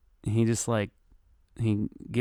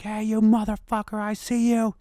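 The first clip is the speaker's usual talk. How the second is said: high, strained retold shout; mock yell; 12 semitones higher than usual. Owner spots the thief heading to Safeway, mock yelling. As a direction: yell after someone at held-back volume. Yells at fleeing bike thief.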